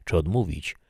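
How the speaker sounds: ordinary delivery, nothing marked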